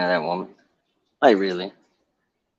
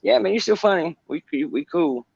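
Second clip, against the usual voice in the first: forced friendly; 7 semitones higher than usual. Retold or imitated. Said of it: "look at 'em now and go".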